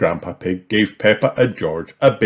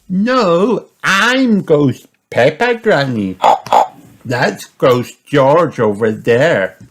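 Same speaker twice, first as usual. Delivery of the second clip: Peppa voice; 7 semitones higher, 6 dB louder, slower than usual. A narrator's reading. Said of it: "pointing and giggling".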